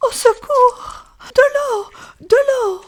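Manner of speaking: very high, loud tearful shout; crying out. Acted. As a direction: call for help with the last of her strength. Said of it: "et criait".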